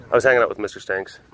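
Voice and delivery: usual speaking voice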